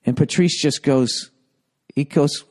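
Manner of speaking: ordinary delivery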